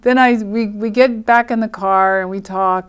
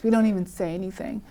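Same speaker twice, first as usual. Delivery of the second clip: ordinary delivery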